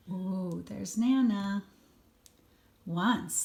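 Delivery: ordinary delivery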